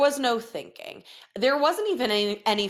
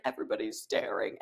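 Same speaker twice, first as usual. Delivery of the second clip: softer, breathy embarrassed murmur; embarrassed whisper; 2 semitones higher, 7 dB quieter, faster than usual. Retold or imitated. Son embarrassed that the restaurant is staring at him. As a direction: mumble it like a shy kid.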